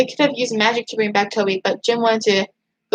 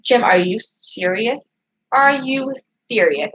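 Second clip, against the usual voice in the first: slow, drawn-out mock indignation; acted disbelief; slower than usual. Vlogger confronting Jim over his words, acted incredulity. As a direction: stretch out the challenge as if appalled.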